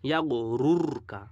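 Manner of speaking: ইয়াগুরুরকা slow with a heavy r; thick full r. Shown as a demonstration. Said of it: "মোটা করে পড়ছি".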